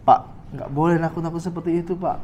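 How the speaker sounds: quiet, softened word of advice; gentler admonition. Acted. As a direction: advise him softly and politely. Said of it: "Atau lebih halus lagi".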